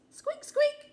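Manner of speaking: very high, loud squeaky mouse voice; mouse squeak. Acted. Narrator squeaking as the mouse for children.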